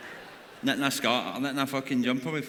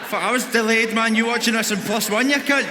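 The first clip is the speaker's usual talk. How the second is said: louder and higher, a barked mock telling-off; mock angry shout; 7 semitones higher, 5 dB louder than usual. Comedian shouts at someone watching on a delay, mock angry. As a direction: yell at the delayed viewer.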